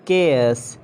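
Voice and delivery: "chaos" as KAY-oss; hard K, two syllables in "chaos"; British pronunciation. Shown as a demonstration. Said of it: "It's a chaos".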